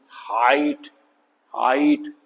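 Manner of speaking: "हाइट" with a drawn-out AI glide; long I. Shown as a demonstration. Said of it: "long आई".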